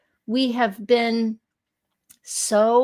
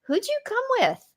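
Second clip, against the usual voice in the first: much higher, lightly voiced little girl; 14 semitones higher, 3 dB quieter than usual. Imitating the little girl at the skate park asking who she came with. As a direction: pitch up to play a curious kid. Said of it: "And she goes".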